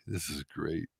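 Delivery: ordinary delivery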